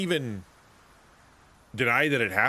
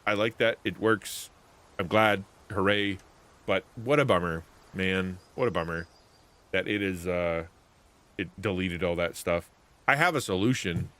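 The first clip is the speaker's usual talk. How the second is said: ordinary talk, nothing marked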